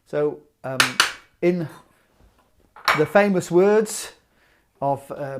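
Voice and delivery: normal talking voice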